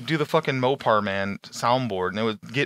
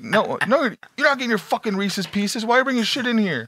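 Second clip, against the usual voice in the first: mildly hammed-up irate staff, pitched up; mock angry; 9 semitones higher than usual. Staff telling off a prank caller, mock angry. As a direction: imitate an exasperated employee scolding a customer. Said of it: "they would get so mad".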